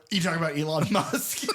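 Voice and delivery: laughing retold question. Retelling the mix-up to a co-host, laughing. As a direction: ask it while breaking into laughter.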